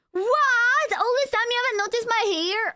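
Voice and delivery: very high, indignant disbelief; mock shock. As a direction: play up outraged surprise, voice shooting up. Mock shock at chat not noticing.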